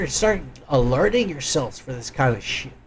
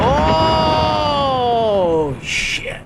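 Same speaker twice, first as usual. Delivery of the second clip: startled; 8 semitones higher, 3 dB louder than usual. Real, not acted. Reacting to drone explosion.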